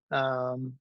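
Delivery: normal talking voice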